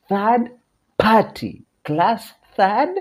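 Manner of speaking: teacher hyping a phrase; playful emphasis. Shown as a demonstration. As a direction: chant it up to the class. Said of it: "playful emphasis on phrase".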